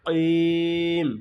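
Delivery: কইম with the vowel stretched long; long drawn কইম; held vowel. Shown as a demonstration. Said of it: "তিন আলিফ টানবো".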